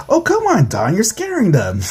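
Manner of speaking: high-pitched acted protest; exasperated chiding. Wife tells husband he's scaring them, exasperated chiding. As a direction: chide him, starting very high.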